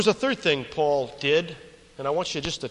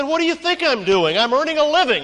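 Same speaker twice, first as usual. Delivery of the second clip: higher and louder, exasperated workman snapping back; indignant retort; 11 semitones higher, 8 dB louder than usual. Retold or imitated. Worker snaps back at the architect, indignant and loud. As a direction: fire it back like the question is absurd.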